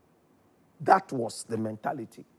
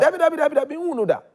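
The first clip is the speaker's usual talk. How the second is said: shocked; 10 dB louder, much faster than usual. Retold or imitated. Retelling shocked reaction to prophecy.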